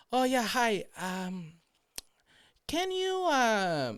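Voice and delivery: high, halting phone-call impersonation; imitated coworker. Coworker starting a call to her son, high halting mock voice. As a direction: stall in a high put-on voice. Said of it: "and she goes".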